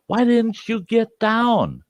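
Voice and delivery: high, slow, drawn-out demand; exasperated question. Retold or imitated. Man challenges bowler after the gunman scare, exasperated. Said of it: "And I go".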